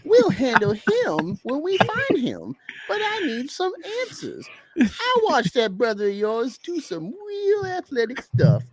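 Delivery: high-pitched, loud character voice; Darren voice. Shown as a demonstration. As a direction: read Darren way up high and forceful. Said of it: "an example of how I read Darren".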